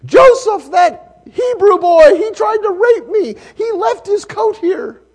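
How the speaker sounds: high, loud accusation; shrill accusing wife. Acted. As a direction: accuse him to the guards, outraged. Wife accuses Joseph to guards.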